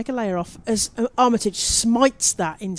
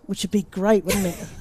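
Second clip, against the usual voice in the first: ordinary delivery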